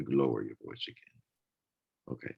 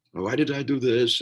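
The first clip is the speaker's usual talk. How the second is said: higher and louder, mock self-reproach; self-blaming inner voice; 7 semitones higher, 6 dB louder than usual. Person scolding themselves, higher and louder. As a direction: play the nagging voice in your own head.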